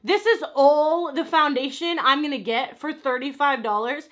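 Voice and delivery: outraged shout at a pricey foundation; furious outburst. Real, not acted. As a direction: explode at the tiny stick of product. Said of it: "I'm so angry about this".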